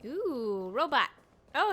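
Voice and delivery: ordinary delivery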